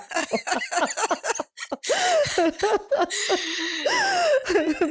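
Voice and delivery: high, helpless laughter; laughing hard at the memory; laughter breaking into a near cry; real burst of laughter. Real, not acted. Laughing at the hockey bag reveal.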